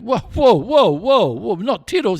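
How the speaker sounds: high, loud acted protest; mock alarm. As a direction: stop them short in mock dismay. Joke character hears cat died.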